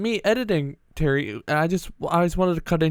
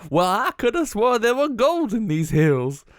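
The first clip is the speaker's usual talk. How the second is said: put-on slow, higher old miner voice; old prospector; 5 semitones higher, slower than usual. Shown as a demonstration. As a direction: talk like a grizzled gold prospector. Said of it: "Yeah, I sound like a prospector".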